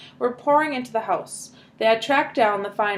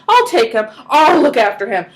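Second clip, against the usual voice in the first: louder, insistent; eager; 3 semitones higher, 9 dB louder than usual. A narrator's reading. Grandpa insists to the family, loud and eager. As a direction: insist with energy.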